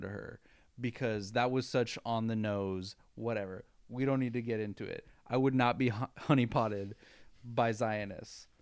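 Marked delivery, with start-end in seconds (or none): none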